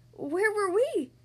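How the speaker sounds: high, loud, slow amazed question; wide-eyed wonder. A narrator's reading. Lola asking about the hurricane, wide-eyed with wonder. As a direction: stretch it out in astonishment. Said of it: "her eyes wide".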